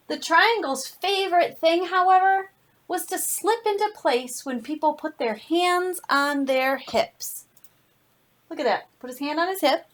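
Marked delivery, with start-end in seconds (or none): 0.1-7.4 lively storybook read-aloud with slowed phrases; reading to children. Acted. Teacher reading a picture book to her class, animated storytelling. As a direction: tell it to a class of kids, savoring each line.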